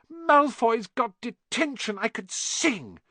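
high, slow delighted voice; gleeful. A narrator's reading. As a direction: savour the good news. Hermione gleeful.

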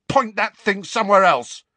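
loud, high, panicky order; frightened demand; alarmed shout. A narrator's reading. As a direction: shout it nervously, pitched right up. Dudley facing the wand.

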